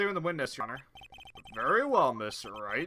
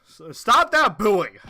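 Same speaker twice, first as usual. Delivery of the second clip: loud, slow, drawn-out order; stern shout; 5 semitones higher, 11 dB louder, much slower than usual. Acted. Judge shouting down the booing gallery, loud and slow. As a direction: bellow it over the crowd.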